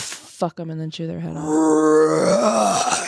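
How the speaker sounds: slow, low growling monster voice; creepy creature voice. Acted. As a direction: growl it like a praying mantis. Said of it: "What the hell was that?".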